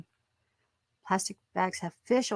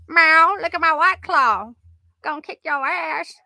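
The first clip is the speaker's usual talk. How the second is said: higher, louder mock cat brag; 7 semitones higher, 7 dB louder than usual. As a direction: meow it out like a cocky cat. Voicing the cat bragging.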